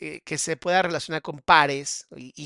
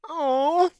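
surprised gasp; 12 semitones higher, 6 dB louder than usual. Real, not acted. Reacts to crying mother remark.

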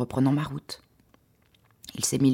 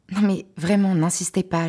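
higher, louder begging to be left alone; urgent plea, voice pitched up; pleading; 6 semitones higher, 4 dB louder than usual. Acted. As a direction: plead with him to stop, almost desperate. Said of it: "j'ai quasi supplié".

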